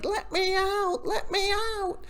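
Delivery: high, sing-song imitation of a trapped captive; mock screaming plea. Reviewer mimics captured girl clawing the wall, mock screaming plea. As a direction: wail it without extra volume. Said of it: "she's screaming and yelling and crying".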